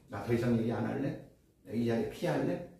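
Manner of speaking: low, soft, self-restrained aside; quiet withdrawal. Acted. Someone leaving before angry words come, quietly restrained.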